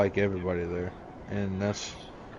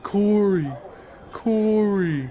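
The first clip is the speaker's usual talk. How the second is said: calling Corey; 10 semitones higher, much slower than usual. Retold or imitated. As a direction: call out for Corey like a helpless coworker. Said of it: "you just hear".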